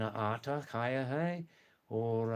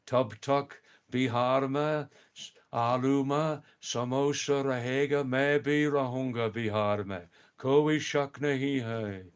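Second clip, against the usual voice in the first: Lalu Yadav impression; 4 dB louder than usual. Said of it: "Please don't mention Lalu Yadav's name".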